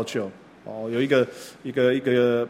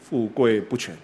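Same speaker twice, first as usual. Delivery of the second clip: careless offhand remark; 5 semitones lower, 6 dB quieter than usual. Shown as a demonstration. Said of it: "有人讲话随便讲".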